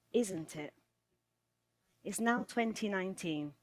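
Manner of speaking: ordinary delivery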